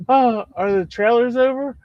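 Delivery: high, dazed just-woken question; groggy friend. Retold or imitated. Friend waking at two thirty, high confused question. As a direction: play the friend waking up clueless. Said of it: "He goes".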